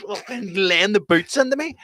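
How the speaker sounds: ordinary talk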